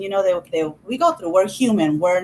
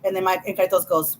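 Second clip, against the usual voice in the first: usual speaking voice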